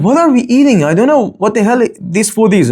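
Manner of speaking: high-pitched, puzzled mock question; acted mealtime complaint. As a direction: act baffled by the food on the plate. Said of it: "I said".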